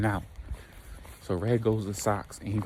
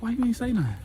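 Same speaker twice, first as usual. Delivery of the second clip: high-pitched mock disbelief; retold indignant surprise; 13 semitones higher, faster than usual. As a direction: ask it high, as if put out. Snake's indignant quote in retold exchange.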